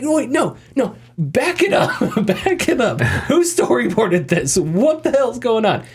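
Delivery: high-pitched, laughing, slowed-down disbelief; incredulous disbelief. Retold or imitated. Gamer reacting to the nonsense death scene, baffled and laughing. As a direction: stop the tape, baffled and half laughing. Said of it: "but I'm just like".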